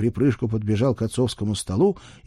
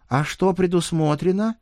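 put-on child voice; 10 semitones higher than usual. Boy asks spirit.

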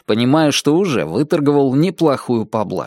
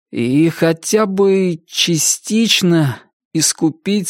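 slow, stumbling, higher youthful voice; halting young reader; 5 semitones higher, slower than usual. Acted. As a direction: sound out each word, tripping on the hard ones. Chuck reads aloud, stumbling. (медленно, запинаясь, юношеский голос)

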